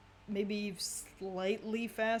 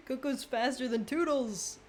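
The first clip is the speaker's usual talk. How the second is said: laughing; 5 semitones higher, 5 dB louder than usual. Real, not acted. Laughing disbelief at fast character.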